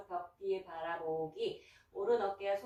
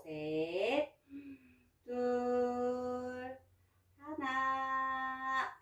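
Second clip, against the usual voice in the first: slow countdown; 5 semitones higher, much slower than usual. Shown as a demonstration. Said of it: "final countdown of stretch".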